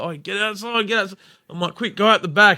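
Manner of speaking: high, hurried-sounding retold command; urgent ordering. Dragging a mate out the back door, urgent ordering. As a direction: shoo him out urgently.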